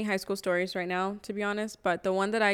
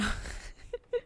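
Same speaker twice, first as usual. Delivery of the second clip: high breathy laugh; 8 dB quieter than usual. Real, not acted. Laughs after admitting bad choices.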